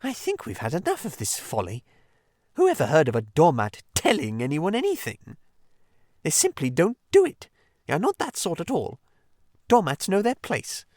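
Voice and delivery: sulky little lecture; petulant. Acted. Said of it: "quite pettishly".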